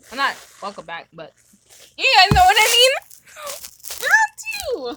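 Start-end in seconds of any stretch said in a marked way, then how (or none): 2.0-5.0 high squeaky helium voice; squeaky announcer on helium. Real, not acted. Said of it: "on freaking Helium".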